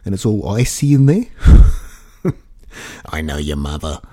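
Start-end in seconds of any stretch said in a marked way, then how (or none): 3.1-4.0 low, soft possessed voice; Exorcist demon voice. Retold or imitated. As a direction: lower and softer, like the possessed girl taunting. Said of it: "in that gravelly voice".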